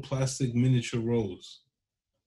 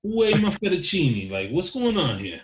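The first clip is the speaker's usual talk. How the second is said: high, loud mobster yelling across the room; Italian mobster voice; 7 semitones higher, 3 dB louder than usual. Pretend. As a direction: bellow it like a wiseguy in a tracksuit. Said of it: "what you talking about there, Tony".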